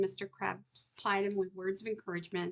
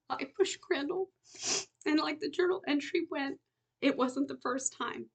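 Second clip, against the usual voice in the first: anguished confession; 7 semitones higher than usual. Acted. Danny's tearful confession.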